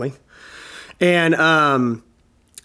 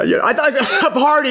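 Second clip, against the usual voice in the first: laughing; 10 semitones higher than usual. Real, not acted. Laughs recalling his reaction.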